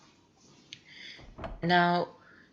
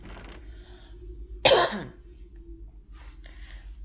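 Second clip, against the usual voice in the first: coughing; 5 semitones higher, 3 dB louder than usual. Real, not acted. Coughing while out of breath.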